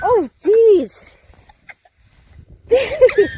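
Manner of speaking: high startled shriek; shocked yelp. Real, not acted. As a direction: yelp as cold snow hits. Snow thrown in her face.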